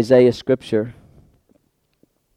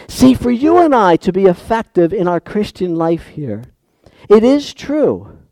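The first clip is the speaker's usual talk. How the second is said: usual speaking voice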